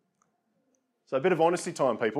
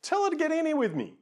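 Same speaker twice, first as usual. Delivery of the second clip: much higher, impatient call; exasperated demand; 13 semitones higher than usual. Retold or imitated. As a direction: demand she get in here, pitched way up. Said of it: "She walked in".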